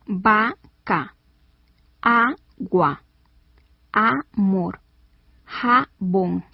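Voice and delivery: each word split into two separate syllables; slow teaching split of each word. Shown as a demonstration. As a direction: pause between syllables, as in "Va-ca", "a-gua". Demonstrates two-syllable words split.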